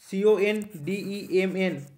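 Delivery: very slow, letter by letter; spelling out. Shown as a demonstration. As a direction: spell it out slowly for a class. Spelling the word out for students. (ধীরে ধীরে বানান করে বলা)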